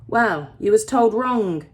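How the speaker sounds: lightly voiced old man putting her right; blunt old miner. A narrator's reading. Old miner correcting the girl, slow and blunt.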